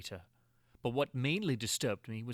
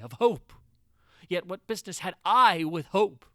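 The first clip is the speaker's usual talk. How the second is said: louder, an anguished cry of hope; sudden hope; 4 semitones higher, 10 dB louder than usual. A narrator's reading. Chained prisoner seizing a moment of hope, loud anguished cry. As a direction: let hope burst out, then question it.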